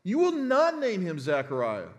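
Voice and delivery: loud, high, firm "No, you don't"; a mother shutting down the room; emphatic refusal. Retold or imitated. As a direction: Elizabeth refuses the family, forceful. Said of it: "Here it's uxki".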